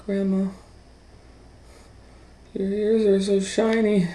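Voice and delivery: put-on a wide-eyed little girl asking.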